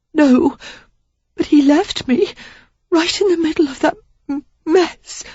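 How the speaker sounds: high, breaking, tearful; stumbling through sobs; tearful young woman. A narrator's reading. Sarah sobbing her confession to Derek.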